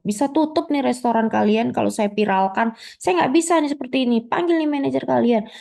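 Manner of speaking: high, fast indignant ranting; an outraged customer at a restaurant; furious customer. Acted. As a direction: threaten to go viral, voice up high.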